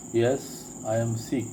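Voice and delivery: ordinary talk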